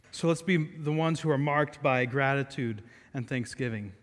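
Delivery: normal talking voice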